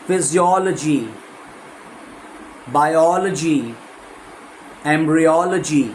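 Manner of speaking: slow, stressing the syllable before logy; ology stress. Shown as a demonstration. Teacher listing words with the same stress. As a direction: hit the syllable before logy each time. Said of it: "एलओजीवाई के पहले एनओ है".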